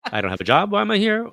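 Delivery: incredulous, voice shooting up; bewildered protest to the boss, climbing high; exasperated disbelief. Retold or imitated. Exasperated self-quote to boss.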